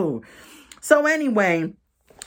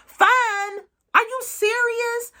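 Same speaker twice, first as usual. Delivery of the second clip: much higher, squeaky shocked outburst; incredulous disbelief; slower than usual. Real, not acted. Viewer reacting to Andy saying the night went fine, incredulous high-pitched outburst. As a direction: cry out in stunned disbelief.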